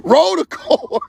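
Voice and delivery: high, laughing roll the corn; high-pitched laughing call to roll the clip; laughing command. Real, not acted. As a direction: call it out while still cracking up. Still laughing, calls for clip.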